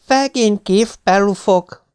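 put-on high, slow lisping child's pity; pitying little child. A narrator's reading. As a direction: pity them in a small child's lisp. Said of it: "szánakozón simogatva őket".

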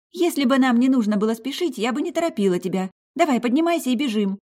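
high, quick playful urging; sly eager friend. Acted. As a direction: coax with a sly grin. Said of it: "лукаво улыбнулась и ответила".

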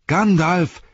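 high, loud cry; shouted. A narrator's reading. Pippin spotting the wizard coming, high loud shout. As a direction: shout the name out high across the walls. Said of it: "schrie Pippin".

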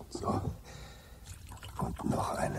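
whispered to himself; whispered aside. Acted. Zafford pouring another drink alone, whispering. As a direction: breathe it out under your breath.

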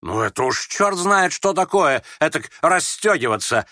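high, loud outburst; indignant yell. A narrator's reading. Ferdyshchenko yelling at the youth's outburst, indignant. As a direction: bellow it in scandalised protest. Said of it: "заорал Фердыщенко".

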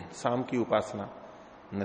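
ordinary talk

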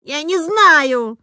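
high, loud, stretched-out wail; cornered pupil crying out; tearful child's yell. A narrator's reading. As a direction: shout it like a child near tears. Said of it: "крик стал резким, визгливым".

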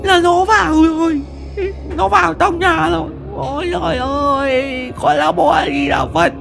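high sobbing panic, stumbling through prayers; terrified crying. A narrator's reading. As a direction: cry out to mother while praying in terror. Terrified wife praying, crying.

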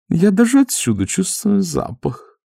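high, fussy lady voice; madame Lada's voice. A narrator's reading. Noticing the smell, high prim lady voice. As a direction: voice a prim lady sniffing the air. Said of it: "заметила мадам Лада".

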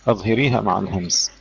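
usual speaking voice

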